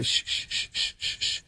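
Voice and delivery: whispered shushing cutting him off; imitating dad shushing. Dad cuts off son's good news, whispered shush. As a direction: hush him, breathy and secret. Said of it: "I said".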